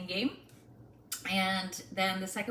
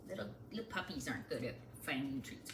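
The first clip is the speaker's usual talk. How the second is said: normal talking voice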